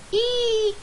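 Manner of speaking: put-on a sudden squeaky girl's shriek; high shriek. Girl character shrieks in fear.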